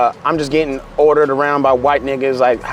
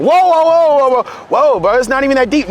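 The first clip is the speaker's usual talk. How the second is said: mock startled whoa; 9 semitones higher than usual. Trainer backing off, startled.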